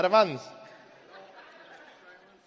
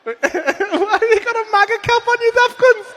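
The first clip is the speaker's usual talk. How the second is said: high, laughing jeer; mock him through laughter; cackling at the cap; laughing mockery; 10 semitones higher than usual. Real, not acted. Mocking a guy in a MAGA cap.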